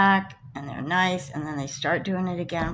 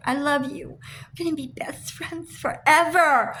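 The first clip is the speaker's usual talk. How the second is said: high, sugary mock of gushing texts; mock gushing friend; 7 semitones higher, 3 dB louder than usual. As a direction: ham up fake affection.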